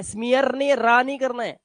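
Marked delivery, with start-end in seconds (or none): 0.0-0.5 स्मियर with the final r pronounced; wrong sounded r. Shown as a demonstration. Teacher warning against स्मियर, final r sounded. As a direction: roll an r onto the end of स्मियर. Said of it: "रा last में नहीं पढ़ना है".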